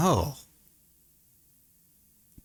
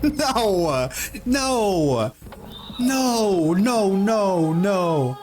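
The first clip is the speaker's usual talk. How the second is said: horrified shouting; 8 semitones higher, much slower than usual. Real, not acted. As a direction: yell no over and over in disbelief. Reacting to animals mating on screen.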